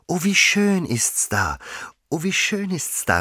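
put-on high-pitched girl in raptures; delighted exclamation. A narrator's reading. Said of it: "rief sie immer wieder". (entzückt ausrufen, mit hoher Stimme)

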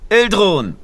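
loud, high call of a name. A narrator's reading. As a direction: call her name across the door. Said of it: "rufst du".

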